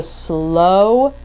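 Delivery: very slow, stretching every word. Shown as a demonstration. Host acting out her tip to podcasters, very slow. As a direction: drag out slow down to act it out. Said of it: "slow down".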